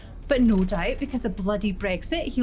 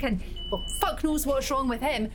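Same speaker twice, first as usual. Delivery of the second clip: ordinary talk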